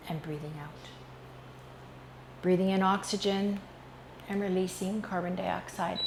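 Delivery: calm, measured hypnotic induction voice; guided meditation. Real, not acted. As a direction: guide the listener into relaxation with unhurried pauses.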